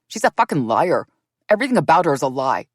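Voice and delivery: David attacks the narrator in front of Robin; furious accusation. A narrator's reading. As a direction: hurl the insult.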